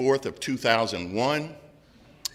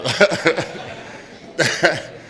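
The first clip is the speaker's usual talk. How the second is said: real laugh; 6 semitones higher than usual. Real, not acted. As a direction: laugh at your own punchline. Laughs at own joke on stage.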